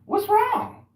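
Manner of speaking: very high, loud worried question; high concerned voice; concerned passer-by. Retold or imitated. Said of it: "I was like".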